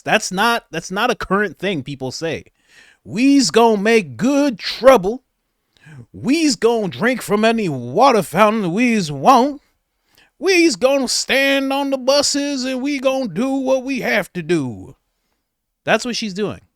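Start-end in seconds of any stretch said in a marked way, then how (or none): 3.1-15.0 slow, louder, higher put-on dialect; hammed-up mock protest speech; exaggerated mock activist. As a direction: ham up the sixties marcher. Mocking her activist speech.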